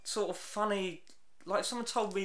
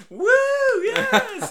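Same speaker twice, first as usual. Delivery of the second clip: much higher and louder whoop of glee; mock cheering crowd; 12 dB louder than usual. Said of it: "everyone's going".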